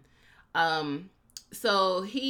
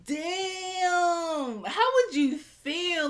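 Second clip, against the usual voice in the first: mock outraged quote; 13 semitones higher, much slower than usual. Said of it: "I was like".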